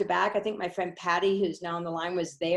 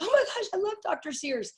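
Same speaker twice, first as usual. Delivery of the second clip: much higher, gushing fan squeal; fangirl excitement; 13 semitones higher than usual. Retold or imitated. Seeing Doctor Sears on the program, a high fan gush. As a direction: gasp it out like meeting your idol. Retelling her reaction to the speaker.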